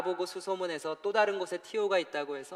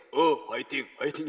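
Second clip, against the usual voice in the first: imitating Jinsu's speech; 3 semitones lower, 5 dB louder than usual. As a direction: mimic his brother's halting cheer. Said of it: "우리 진수 말투가 이래요".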